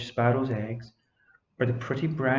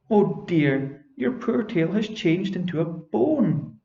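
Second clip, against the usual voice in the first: dismayed fairy; 6 semitones higher, slower than usual. Acted.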